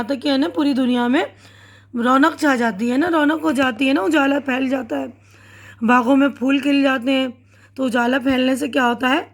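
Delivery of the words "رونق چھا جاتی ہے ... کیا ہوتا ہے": normal talking voice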